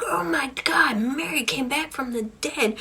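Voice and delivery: hammed-up panic, pitch jumping up; freaking out. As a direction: act out a man in horrified disbelief. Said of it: "he's freaking out".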